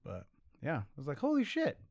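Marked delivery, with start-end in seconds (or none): 1.2-1.8 high-pitched amazed exclamation; retold amazement. Co-host retelling finding out about Hanks's brother, amazed. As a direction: let the pitch leap up in wonder.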